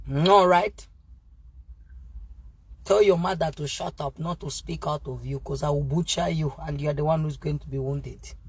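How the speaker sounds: ordinary talk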